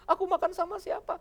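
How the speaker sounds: high, mock-tearful wife; lightly hammed worried wife; mock abandoned wife. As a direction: whine like a wife left alone. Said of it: "Begitu suami pergi".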